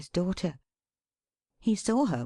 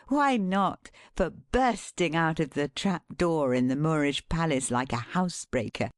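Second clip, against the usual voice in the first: plain reading voice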